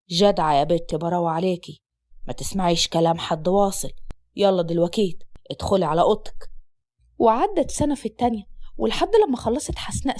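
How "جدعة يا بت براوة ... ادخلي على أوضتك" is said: much lower, gruff-sounding father voice; father answering his daughter in a deep voice; stern father. Acted. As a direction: drop low and heavy as the strict father.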